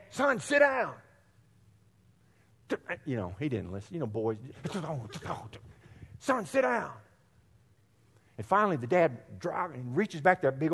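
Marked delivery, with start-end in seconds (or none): 0.1-0.9 higher, firm fatherly order; a hint of a stern father calling to the back seat; stern dad. Dad tells bouncing boy sit. 6.2-6.9 higher, firm second warning from the father; the dad telling his son a second time; lightly voiced father, losing patience; stern dad, repeated. Dad repeats order, boy ignores.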